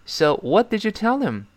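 "So what did you tell him" rising high, then falling at the end; falling question tune. Shown as a demonstration. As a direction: read it out clearly, dropping the pitch on "tell him". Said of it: "注意特殊疑问句最后一定是降调". (特殊疑问句，tell him降调收尾)

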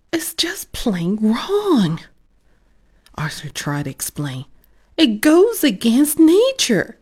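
put-on a boy pleading it's wrong; indignant kid. Arthur pleads with parents.

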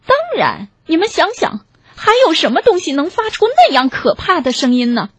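high-pitched, knowing owl. A narrator's reading. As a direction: explain it as the clever one.

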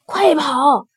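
high cry to run; frightened animals calling out; urgent shout. Acted. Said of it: "他们齐声喊道". (急切地喊叫)

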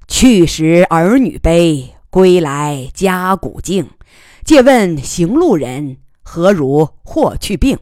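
poem recitation. Acted. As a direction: recite the old poem with weight.

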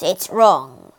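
low, heavy sigh on wrong. Acted. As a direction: let wrong sink on a sigh. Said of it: "sighed Sir Simon".

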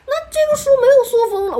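high, loud whiny complaint; mock the fussy customer. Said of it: "你说". (高声尖细地抱怨)

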